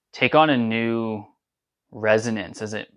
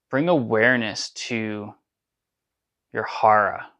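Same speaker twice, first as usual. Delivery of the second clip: ordinary delivery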